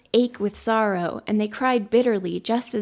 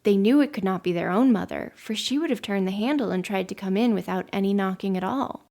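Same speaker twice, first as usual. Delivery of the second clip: usual speaking voice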